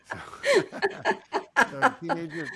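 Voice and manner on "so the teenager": louder words through laughter; laughing. Real, not acted. Guest resuming the story while still laughing.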